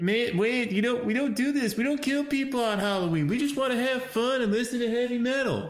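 pleading sidekick. Acted. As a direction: plead with your pal in a put-on high voice.

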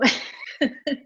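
short breathy nervous laugh. Real, not acted. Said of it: "Can you tell it's a nervous laugh".

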